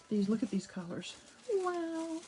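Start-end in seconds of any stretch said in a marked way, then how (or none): 1.6-2.2 high, delighted wonder; delighted wow. Real, not acted. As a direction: gush over the colors. Admiring colorful tissue paper.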